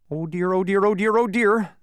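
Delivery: high, mounting fluster; nervous fretting. A narrator's reading. Bee frets against the countdown, nervous and high. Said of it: "continuing to pace nervously".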